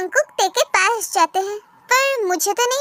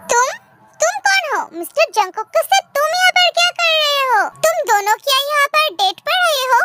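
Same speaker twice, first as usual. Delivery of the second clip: put-on higher, accusing girl confronting a stranger; a jealous girl demanding answers, pitched up; jealous rival girl; 6 semitones higher than usual. (ऊँची आवाज़ में जलन भरी लड़की, सवाल करती हुई)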